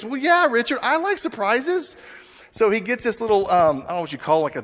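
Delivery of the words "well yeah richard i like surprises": coy flirt. Retold or imitated. Woman flirting with Richard about surprises. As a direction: drag it out sweetly, much higher. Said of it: "She goes".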